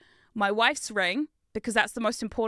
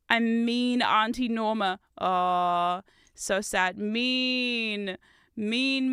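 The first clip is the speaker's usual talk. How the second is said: normal talking voice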